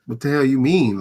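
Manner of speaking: ordinary talk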